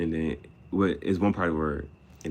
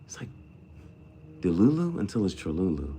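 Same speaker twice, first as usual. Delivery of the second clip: ordinary delivery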